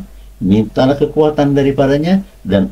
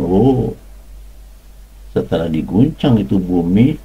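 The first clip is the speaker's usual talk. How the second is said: ordinary delivery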